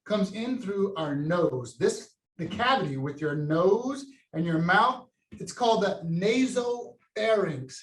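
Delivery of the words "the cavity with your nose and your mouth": ordinary delivery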